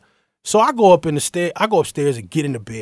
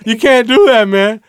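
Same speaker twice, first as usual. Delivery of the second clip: put-on higher, louder drunk pleading; drunk friend; 7 semitones higher, 7 dB louder than usual. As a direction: lecture your buddy like a drunk friend. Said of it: "he's drunk off his ass".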